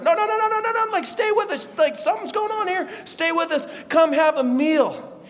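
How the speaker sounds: high, rapid begging that eases off; urgent pleading. Acted. Disciples urge stranger to stay.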